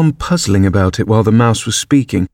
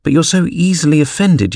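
higher-pitched pleading protest; 7 semitones higher than usual. A narrator's reading. Alice excuses herself to Mouse, pleading. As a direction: plead gently with raised pitch. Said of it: "pleaded poor Alice".

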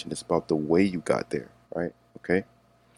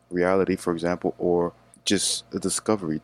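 ordinary talk, nothing marked

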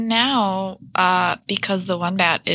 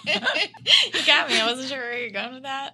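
laughing; 7 semitones higher than usual. Real, not acted. Laughing after the ghost joke.

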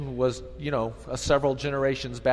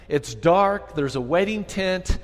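normal talking voice

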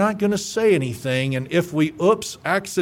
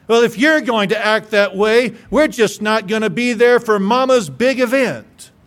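louder, higher, huffy ultimatum; indignant family threat; 5 semitones higher, 5 dB louder than usual. Retold or imitated. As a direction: throw it down like an offended relative.

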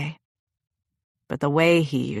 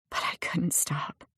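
whisper; 8 dB quieter than usual. A narrator's reading. Narrator near tears.

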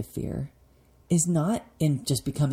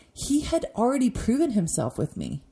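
normal talking voice

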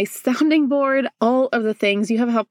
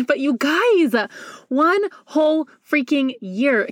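fired up; 4 semitones higher, slower than usual. Real, not acted. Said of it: "I am fired up".

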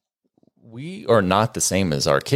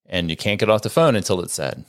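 normal talking voice

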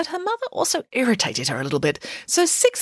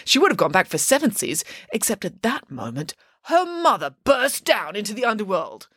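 plain reading voice

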